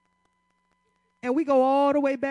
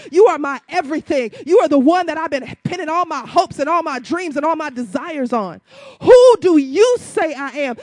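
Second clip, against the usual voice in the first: full-force preaching; 3 semitones higher, 10 dB louder than usual. Acted. Preacher to congregation, building to a loud climax.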